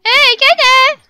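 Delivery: loud, high-pitched holler; calling out. Pretend. Streamer hollers for a child in her game, loud calling out. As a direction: yell the name to get attention.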